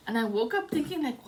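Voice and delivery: ordinary talk, nothing marked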